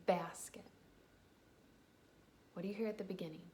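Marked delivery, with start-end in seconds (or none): 0.1-0.7 "Basket" said carefully, first sound leaned on; slow word demo. Shown as a demonstration. Teacher models first sound for children, slow "Basket". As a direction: sound out "Basket" slowly and clearly. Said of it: "Say that slowly".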